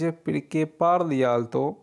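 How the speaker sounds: very slow, each syllable clear; slow model sentence. Shown as a demonstration. Teacher reading the Italian phrase for students, very slow. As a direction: read it out slowly for learners to repeat. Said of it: "किस तरह आखने Italian च".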